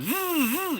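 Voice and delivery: very high buzzing zzz of the fishing line; high reel-buzz imitation; imitated line whizz. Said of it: "That's, that's how he said it".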